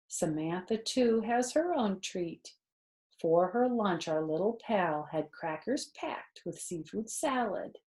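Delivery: ordinary delivery, nothing marked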